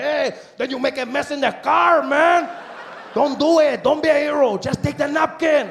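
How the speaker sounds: put-on loud, high Mexican-accented pleading. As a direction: vendor urging him to take napkin, Mexican accent. Vendor pleads, voiced.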